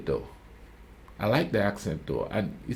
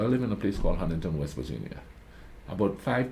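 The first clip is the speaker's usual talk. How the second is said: ordinary talk, nothing marked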